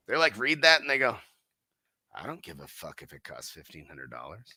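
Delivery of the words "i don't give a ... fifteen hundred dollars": much lower, much softer gruff mutter; a buyer who couldn't care less about cost. Retold or imitated. Owner voicing a buyer shrugging off the price, low soft mutter.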